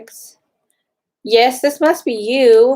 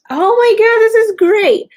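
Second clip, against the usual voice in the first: much higher and louder burst of delight; delighted squeal; 13 semitones higher, 8 dB louder than usual. Real, not acted. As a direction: burst out high and loud with joy. Finding a bag she loves.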